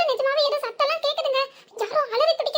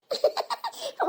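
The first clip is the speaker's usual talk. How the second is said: a short muffled giggle; stifled laugh; 2 semitones higher, 5 dB quieter than usual. Acted. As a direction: laugh under her breath. Wife laughing at hiding sister-in-law. (அடக்கிய சிரிப்பு)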